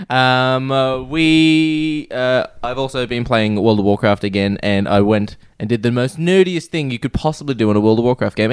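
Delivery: ordinary talk, nothing marked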